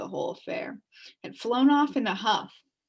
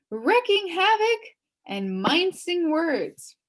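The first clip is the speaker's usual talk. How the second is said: officious charge list; 4 semitones higher, 4 dB louder than usual. Acted. Policeman charging Milo with crimes, pompous and building. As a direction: read the charges with mounting pomp.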